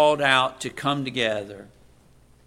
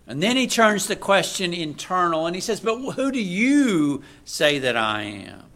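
raised, loud preacher's voice building to Peter's answer; preaching at full force; 5 semitones higher, 8 dB louder than usual. Acted. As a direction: proclaim it from the pulpit.

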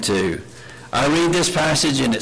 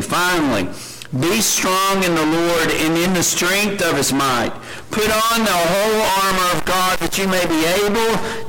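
raised, measured reading of scripture; 5 semitones higher than usual. Acted. Preacher reading Ephesians to the church, raised and solemn. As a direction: proclaim the verses to the congregation. Reads Ephesians six aloud.

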